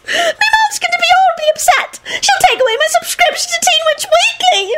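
very high, tearful wail; bawling. A narrator's reading. Girl dreading her mom's reaction, bawling. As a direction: bawl like a distraught schoolgirl. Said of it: "another bawled loudly".

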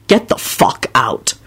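low, slow dismissal; menacing. A narrator's reading. Father ordering intruders out, menacing. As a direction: drop low and spell it out.

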